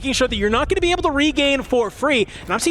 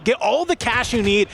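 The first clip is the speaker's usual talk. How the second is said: usual speaking voice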